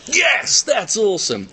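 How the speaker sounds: high excited cheer; excited yes. Retold or imitated. Trainer reacting to eager newcomers, high excited cheer. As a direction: burst out with delight. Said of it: "I'm just like".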